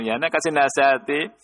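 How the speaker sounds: normal talking voice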